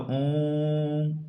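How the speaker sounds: ओम held long and slow as a pluta vowel; drawn-out om. Shown as a demonstration. Teacher showing students a pluta vowel, slow drawn-out ओम. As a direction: stretch the ओ of ओम like a chant. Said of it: "प्लुत स्वर जैसे क्या करते हैं".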